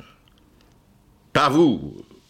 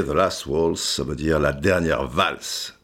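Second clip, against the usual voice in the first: usual speaking voice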